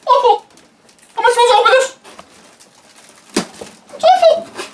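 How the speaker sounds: loud, high furious outburst; outraged yelling. Real, not acted. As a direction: yell it in outrage at the package. Angry unboxing rant opening.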